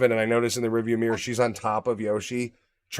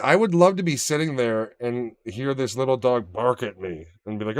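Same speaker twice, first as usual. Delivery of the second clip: ordinary talk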